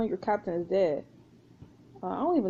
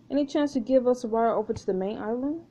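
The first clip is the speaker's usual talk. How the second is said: usual speaking voice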